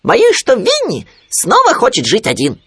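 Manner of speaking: very high, loud little animal; Khryunya objecting. A narrator's reading. Khryunya contradicting Owl, high piping voice. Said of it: "возразил Хрюня".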